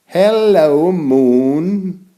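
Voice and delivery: high, bright call to the moon; cheerful greeting. Acted. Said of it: "I said".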